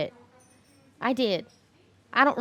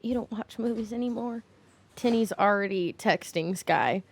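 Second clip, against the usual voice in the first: ordinary talk, nothing marked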